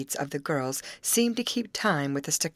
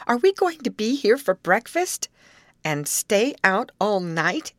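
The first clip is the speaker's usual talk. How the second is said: alarmed; 6 semitones higher, 6 dB louder than usual. A narrator's reading. Girls alarmed at staying lost.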